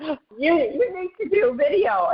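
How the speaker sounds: high, pushy quote of Nadia; lightly voiced bossy mentor; imitating Nadia. A hint of Nadia urging [name] to film video. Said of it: "she's like".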